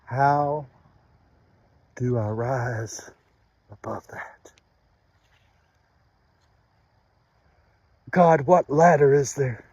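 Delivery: broken, pleading prayer to God, low and hushed at first; grieving prayer. Acted. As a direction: cry out to God in grief, then press on. Prays aloud to God.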